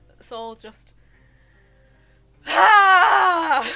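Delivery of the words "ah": loud open-mouthed scream; venting scream. Real, not acted. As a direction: let it all out in a scream. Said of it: "I think I need to do this".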